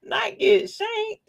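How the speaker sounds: high slow protest; slow high mock horror; mock dismay. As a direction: gasp it out, scandalized.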